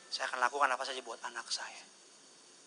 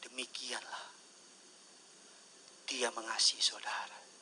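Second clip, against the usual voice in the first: soft, unvoiced, intimate preaching; whispered sermon; 3 semitones lower, 9 dB quieter than usual. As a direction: lean in and whisper the promise.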